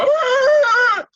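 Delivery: very high, loud otter squeal; high squeaking otter cry of alarm; straining otter cry. Acted. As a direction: squeal while dangling in the air. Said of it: "You hear him go".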